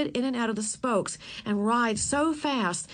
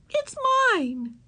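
much higher, stubborn claim; cling to it; girl claiming it, much higher; 3 dB louder than usual. Acted. All Ramona could say.

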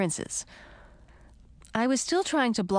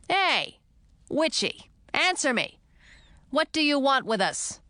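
taunting call; 7 semitones higher than usual. A narrator's reading. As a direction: heckle the witch. Taunting witch, calling.

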